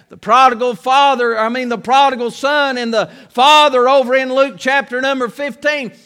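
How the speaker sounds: ordinary talk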